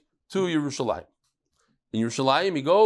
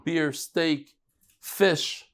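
ordinary delivery

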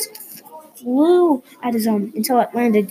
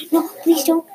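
mild, higher-pitched plea; scream in terror; 6 semitones higher, 3 dB louder than usual. A narrator's reading. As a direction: plead with a touch of fright. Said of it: "Barbara screamed in terror".

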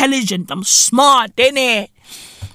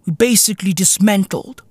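ordinary talk

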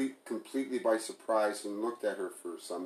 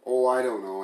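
sarcastic; 4 semitones higher, 10 dB louder than usual. A narrator's reading. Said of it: "Emily said sarcastically".

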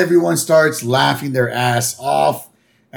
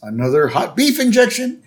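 higher goofy cartoon voice; higher Homer impression; 7 semitones higher than usual. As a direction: play the dopey dad, pitched up. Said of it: "Homer goes".

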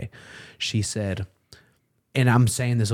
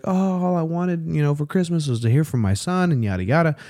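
imitating mom's breezy, higher-pitched chatter; higher voice, carrying on as if nothing's wrong; mother acting fine; 7 semitones higher than usual. Mother on the phone to her estranged son, acting fine. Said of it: "she's talking like everything's fine".

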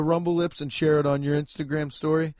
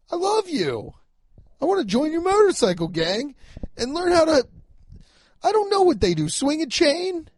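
very high, strained pleading rant; hammed-up high-pitched outburst; comic screamed rant; 12 semitones higher than usual. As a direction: holler up high at an absent friend.

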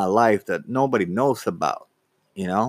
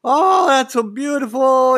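imitating mom, high and loud with delight; happy mom; 5 dB louder than usual. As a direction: gush like a thrilled mother, pitched way up. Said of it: "she gets all happy".

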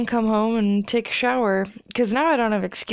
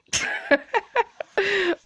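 breathy whispered laugh; 11 semitones higher than usual. Real, not acted. Laugh after joke.

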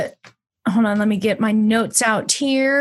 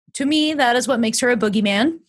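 normal talking voice

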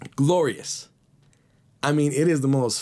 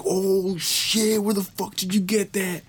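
high, excited disbelief; jaw-dropped homie yelling in surprise; surprised; 8 semitones higher, slower than usual. Retold or imitated. Homie sees the fat sack of weed, high and amazed.

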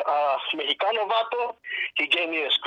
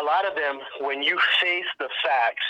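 normal talking voice

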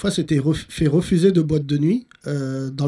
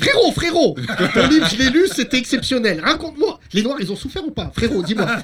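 mock rowdy TV panel host; 6 semitones higher than usual. Host mocking a noisy Hanouna-style panel, high hyped shout. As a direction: ham up a brash talk-show host pushing his guest. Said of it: "Attends, je te le fais".

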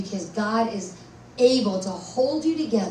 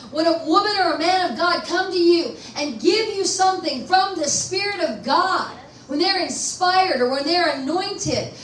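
building, raised preacher voice; preaching; 6 semitones higher than usual. Acted. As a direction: drive it home to the church. Pastor preaching with rising force.

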